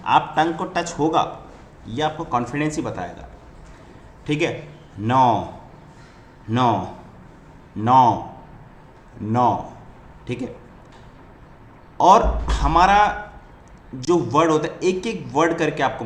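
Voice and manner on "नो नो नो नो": नो said slowly, each one loud and separate; careful no drill. Shown as a demonstration. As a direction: repeat नो without the tongue touching up. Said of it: "टंग को हमको ऊपर touch नहीं करना है". (धीरे साफ़ दोहराया नो)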